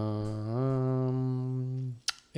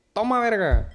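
high, loud coarse handoff; rough friendly shout; 9 semitones higher, 6 dB louder than usual. Retold or imitated. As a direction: bark it like a joking cook. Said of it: "y me dice". (grito burlón)

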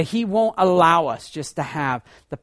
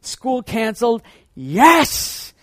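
higher, louder burst of joy; kid's cheer; 6 semitones higher, 8 dB louder, much slower than usual. Retold or imitated. Remembered child cheering at the snow-day news, excited. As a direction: shout it like a kid hearing school is off. Kid hears school canceled.

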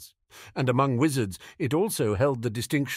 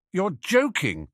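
dubious; 8 semitones higher, 3 dB louder than usual. Acted. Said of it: "Fenrir said dubiously".

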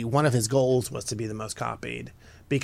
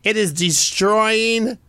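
put-on higher, louder dramatic accented pronouncement; slow, higher mock accent condemning jeans; mock Italian accent; 7 semitones higher, 4 dB louder, slower than usual. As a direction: declare it like an outraged couturier in a put-on accent. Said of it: "So here's his quote on the jean".

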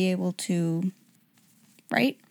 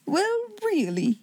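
high-pitched character voice, lightly amused; amused cry; 9 semitones higher, 3 dB quieter, slower than usual. A narrator's reading. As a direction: cry it out slowly in tickled disbelief. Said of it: "he cried".

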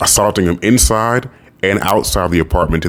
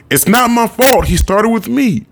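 put-on much higher, whiny kid shrieking; imitated child shriek. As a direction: act out a boy protesting shrilly. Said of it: "Chiron would shriek".

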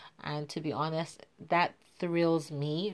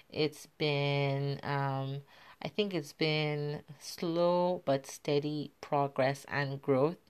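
normal talking voice

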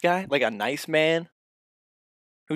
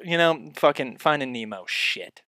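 ordinary talk

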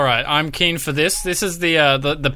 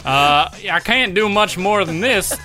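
a lightly put-on Southern US voice, higher; American Southern accent; 5 semitones higher than usual. Cohost performs his American accent on request, mild Southern. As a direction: drawl it like an old Southerner, half in jest. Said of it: "I actually wanna hear you do it".

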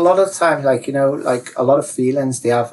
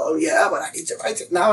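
higher, insistent excuse; protesting; 8 semitones higher than usual. Acted. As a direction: push back against the advice. Arguing back at the advisor.

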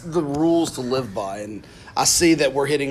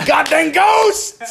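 mock shout; 6 dB louder, much slower than usual. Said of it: "for you to stand up".

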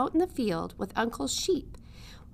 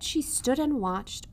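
plain reading voice

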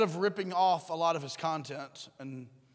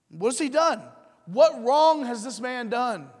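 pleading Pilate; 5 semitones higher, 5 dB louder than usual. Retold or imitated. Pilate pleads with the crowd for Jesus, higher and louder. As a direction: beg them to see reason.

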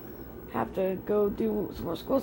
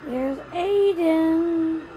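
excited; 5 semitones higher, 5 dB louder, much slower than usual. Real, not acted. Spotting son, excited.

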